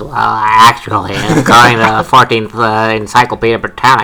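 nerd voice. Pretend. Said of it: "with our best nerd voices".

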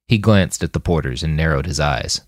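plain reading voice

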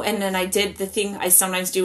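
ordinary talk

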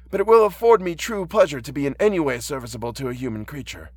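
put-on high-pitched, gentle old blind man; kindly old man. Reads the blind old man's part.

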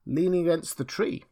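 high, loud, assured answer; villagers exclaiming. A narrator's reading. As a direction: point him out eagerly to a stranger. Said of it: "exclaimed two or three".

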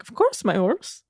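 "horse" lifted in a rising tune; imitated inflection. Co-host mimics friend's movie quote, with inflection rising on "horse". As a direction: lilt up on "horse". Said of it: "like, an affliction in the voice".

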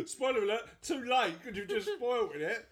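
high, laughing complaint; amused mock outrage; mock protest. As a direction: protest playfully, voice pitched right up. Mock protest at plot spoiler.

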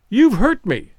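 put-on high little girl's voice, hurt and reproachful; a small child complaining she was hurt. A narrator's reading. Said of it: "said a childish voice reproachfully".

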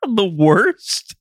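very high-pitched questioning disbelief; incredulous. Real, not acted. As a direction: throw it back in shocked disbelief. Stunned by friend's verdict.

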